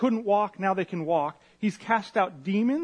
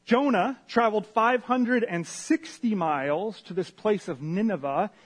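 ordinary talk, nothing marked

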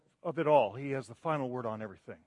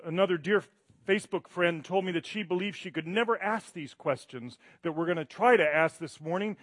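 normal talking voice

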